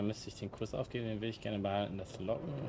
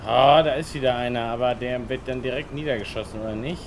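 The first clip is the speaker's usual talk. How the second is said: suddenly much louder, fired up at the screen; real gamer yell at the screen; loud excited outburst; 3 semitones higher, 12 dB louder than usual. Real, not acted. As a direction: shout it out as an enemy appears. Streamer reacts to enemy in game.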